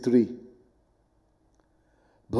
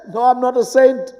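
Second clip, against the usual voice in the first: normal talking voice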